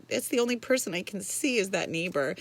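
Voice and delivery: ordinary delivery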